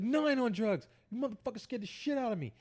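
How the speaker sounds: rattled protest retold. Scared man denying drugs to police, high indignant protest.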